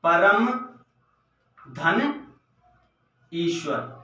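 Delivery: परम धन ईश्वर said as three separate words, each after a long pause; teacher spelling out परम धन ईश्वर with long gaps; split into parts. Shown as a demonstration. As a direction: pull परम, धन and Ishwar apart slowly for the class. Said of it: "तो परमेश्वर किस प्रकार से होगा".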